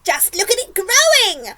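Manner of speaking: high, loud astonished cry; excited cry. A narrator's reading. Aunt marvels at the peach growing, excited cry. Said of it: "Aunt Spiker cried".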